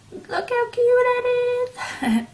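very high slow coo; cutesy voice. Real, not acted. Cooing over something cute.

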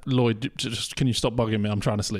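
low, weary dog voice; talking dog. Acted. Dog telling its owner to stop bugging, low weary voice. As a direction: grumble it low as a sleepy dog. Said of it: "What if your dog spoke and said".

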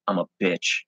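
quiet, calm "I'm a bitch"; calm admission. A narrator's reading. Abby calmly admitting it to the narrator, quiet and flat. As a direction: say it softly, without any heat. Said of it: "she calmly said".

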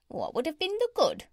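high, pert parrot retort. Acted. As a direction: toss back a high-pitched parrot question.

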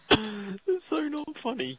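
slow, high, laughing put-on voice; high slow character voice. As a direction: drag it out high and giggling.

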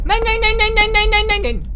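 high, loud frantic repeats; can't stop asking what it is; real panic, words tumbling out; panicked babble. Real, not acted. Panicking after scare.

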